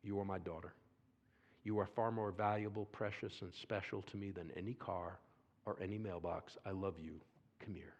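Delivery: low, soft, reassuring voice; tender father. Retold or imitated. Father reassures daughter after wrecked car, tender and soft. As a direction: speak gently like hugging your child. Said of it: "He said".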